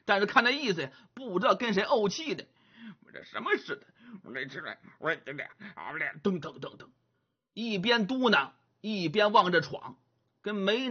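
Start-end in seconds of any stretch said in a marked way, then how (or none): 3.0-6.9 softer, grumbling under his breath; grumbling mutter. Acted. Sulky young man grumbles to nobody as he walks, soft mutter. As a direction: mutter angrily to yourself. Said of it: "嘴里嘟嘟囔囔的".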